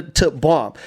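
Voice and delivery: ordinary talk, nothing marked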